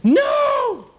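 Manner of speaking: long high-pitched scream; loud, drawn-out shriek far above normal pitch. Acted. A kid screaming at the pool as a beating starts, scream. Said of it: "I hear a scream".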